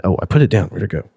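ordinary talk

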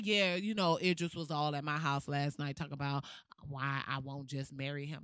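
low, soft mock boast about Idris; mock bragging. Mocking false claims, she brags softly about Idris. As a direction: dreamily brag about a made-up romance. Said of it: "That's like me saying".

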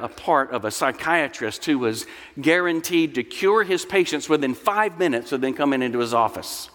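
normal talking voice

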